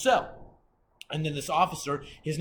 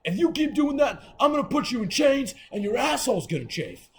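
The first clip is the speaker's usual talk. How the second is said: high, loud imitation of a threatening guard; officer's angry threat; 9 semitones higher, 6 dB louder than usual. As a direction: threaten him like a fed-up jailer.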